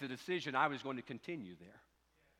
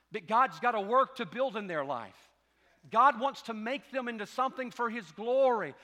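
louder, higher exhortation to parents; fervent preaching; 6 semitones higher, 5 dB louder than usual. Real, not acted. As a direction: press the point home.

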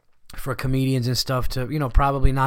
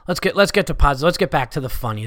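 ordinary delivery

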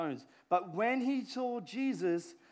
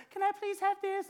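put-on child begging; 13 semitones higher, 3 dB louder than usual. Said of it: "kids come up to you".

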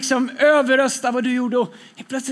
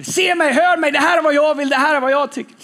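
high, louder, insistent clamour for attention; mock shouting crowd; 4 semitones higher, 4 dB louder than usual. Preacher voicing everyone screaming for attention, raised insistent mock shout. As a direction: act out people shouting to be heard. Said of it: "Alla skriker".

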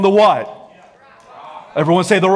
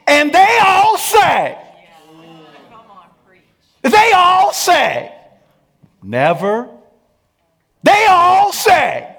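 high, loud, slow hammed-up they all say; mock yelling spouse; 13 semitones higher, 7 dB louder, slower than usual. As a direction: shout they all say like a nagging partner. Mock spouse citing others.

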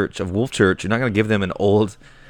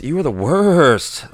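slow exasperated insult; 5 semitones higher, 4 dB louder, much slower than usual. Acted. Fed up with Shaman.

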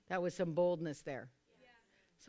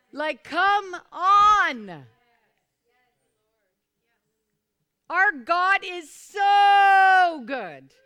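a long, slow shout of praise, much higher and louder; ecstatic shout; 8 semitones higher, 16 dB louder, much slower than usual. Real, not acted. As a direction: cry it out to the rafters, stretching every word. Preacher peaks in praise.

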